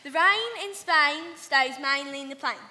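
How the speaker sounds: cockney correction. Shown as a demonstration. Flower girl corrects Annie in a cockney accent. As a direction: model it again in cockney. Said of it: "No, no".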